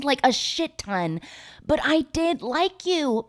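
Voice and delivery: high, stretched-out mock whine; hammed-up high, defensive wail; mock pleading. Retold Miranda defending herself.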